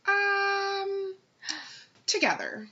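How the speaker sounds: long high "um", then a reluctant "together"; mock reluctant date; imitated hesitation. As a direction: stall on a drawn-out um before answering. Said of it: "and then he's like".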